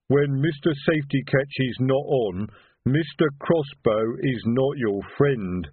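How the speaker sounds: troll's rote recital, dragging at the end; reciting troll. Acted. Troll repeating the safety lesson to his boss, recited. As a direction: recite the rule like a slow troll. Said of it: "recited Detritus".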